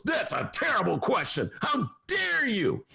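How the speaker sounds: high, slowed mock outrage; mock indignant shout, pitched high and drawn out; mock mayor's outrage. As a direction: ham up the mayor scolding a reporter. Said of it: "And Lori Lightfoot goes".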